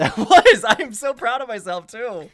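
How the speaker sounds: high, loud and delighted; giddy pride. Real, not acted. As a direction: crow about it playfully. Proud after improv joke.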